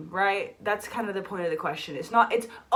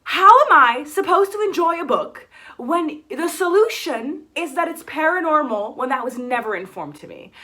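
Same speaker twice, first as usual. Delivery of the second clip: exasperated rant; 5 semitones higher, 7 dB louder than usual. Real, not acted. Ranting about the book's ending.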